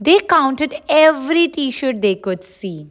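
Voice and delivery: plain reading voice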